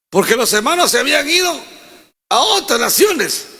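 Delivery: fast high rush that slows into a shout on "otras naciones"; building shout. Acted. Preacher stressing the brethren's flight, rising shout.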